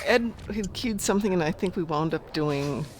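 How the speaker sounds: ordinary talk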